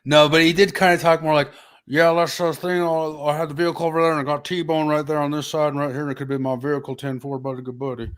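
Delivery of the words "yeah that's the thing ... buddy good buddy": put-on a mild Southern CB-radio trucker accent; hint of a Southern trucker accent. Man retelling the crash in a light Southern trucker accent. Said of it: "not fully accented like that".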